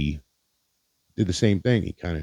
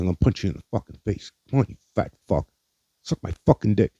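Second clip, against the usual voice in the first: higher-pitched imitation of a bully's threat; retold violent threat; 4 semitones higher, faster than usual. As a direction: act out his menacing demand, only partly heated. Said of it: "threaten me with violence".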